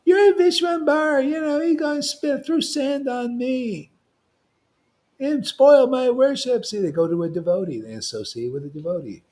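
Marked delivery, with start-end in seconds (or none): 0.0-6.6 much higher whiny mock complaint, slowing mid-way; complaining Brahmin. Brahmin complaining to Vishwambara's father, high mock whine. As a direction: tattle to the father in a pitched-up whine. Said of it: "They go complain to the, his father".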